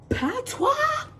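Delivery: very high, drawn-out dramatic reveal; dramatic exclamation. Acted. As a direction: throw the word out high, like a mock-shocked question. Dramatic reveal after long pause.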